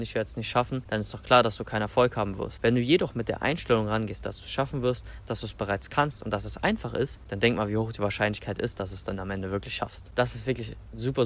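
ordinary talk, nothing marked

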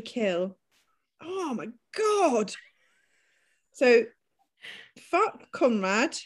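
usual speaking voice

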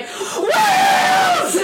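high, loud shout of whales; excited shout. Retold or imitated. Friends spotting whales from the car, shouting. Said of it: "We're both like".

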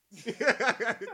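high loud laugh. Real, not acted. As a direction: burst out laughing. Laughing at own joke.

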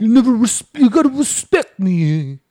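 hammed-up godfather demanding respect; Godfather impression. Retelling the yell at her sister as the Godfather. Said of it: "The Godfather".

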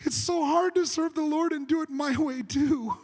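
high-pitched hammed-up lament; mock whining. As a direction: moan it like a put-upon complainer. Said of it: "you could say it this way".

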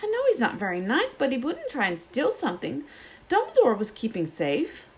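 a shocked schoolgirl defending a teacher; Hermione objecting. Acted. Hermione insists to Harry and Ron that Snape wouldn't. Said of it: "she said".

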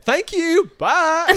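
very high, very loud mock Southern twang; copied Southern accent. Host reliving her accidental accent slip, hammed-up Southern accent. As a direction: blow up the borrowed Southern sign-off. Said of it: "I said".

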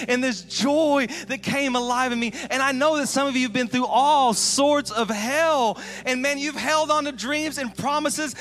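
loud, high, pleading sermon voice; full-force preaching. Acted. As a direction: drive it home to the hurting congregation.

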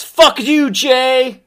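high, drawn-out yell; hammed-up shout of defiance; imitated defiant shout. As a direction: yell the insult like a wrestler in the ring. Quotes favorite shouted moment.

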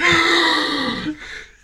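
imitating a gasp. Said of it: "you were like".

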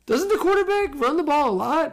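put-on a high, innocent kid's question; dad imitates his son asking, high voice; son's voice. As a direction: mimic the puzzled son.

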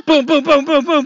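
mimicked booms. Mimicking a rough bumpy ride.

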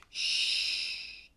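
whispered shushing sound; a hushing shh like calming a child; soft shush. Acted. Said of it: "because you say".

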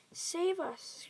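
high-pitched acted scream; screamed plea. A narrator's reading. Giant thrashing in a nightmare, high panicked scream. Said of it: "screamed the flesh lump eater".

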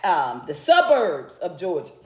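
ordinary talk

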